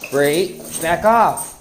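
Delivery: sharp loud telling-off; scolding a dog. Real, not acted. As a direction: shoo the dog off.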